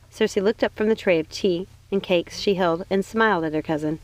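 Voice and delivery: plain reading voice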